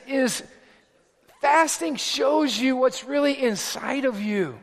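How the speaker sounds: ordinary talk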